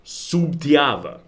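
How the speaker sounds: slow "Subtiava", early B sounded, later one a V; B then V. Shown as a demonstration. Showing viewers the barrio "Subtiava" with extra B, B then V. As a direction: draw out "Subtiava" with B before the T and V near the end. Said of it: "early one kind of like a B".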